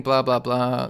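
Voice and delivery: ordinary talk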